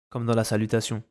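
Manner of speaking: usual speaking voice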